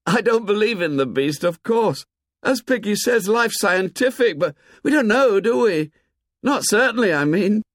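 high-pitched, gaily voiced child; light-hearted boy. A narrator's reading. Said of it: "He paused again and laughed gaily".

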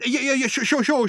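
high, hasty cutting-in; hurried interruption. A narrator's reading. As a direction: cut in quickly to stop the bellowing. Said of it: "interrupted Ford hurriedly".

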